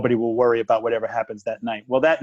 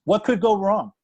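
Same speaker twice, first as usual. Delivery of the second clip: high-pitched, breezy rhetorical question; breezy irony; 8 semitones higher, 3 dB louder than usual. Real, not acted. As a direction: toss it up high, as if nothing could. First take of ironic question.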